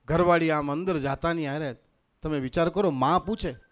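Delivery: ordinary talk, nothing marked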